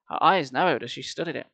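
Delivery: plain reading voice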